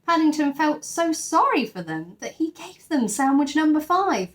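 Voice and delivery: ordinary delivery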